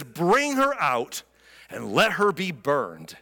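a slow, stern verdict; stern demand. Retold or imitated. Judah condemning Tamar, slow stern demand. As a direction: hand down the sentence slowly and coldly. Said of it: "Judah demanded".

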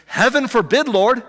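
lightly voiced Peter crying out; mock protest. Peter protesting to Jesus, hammed-up cry. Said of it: "He says".

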